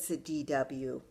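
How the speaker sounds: ordinary delivery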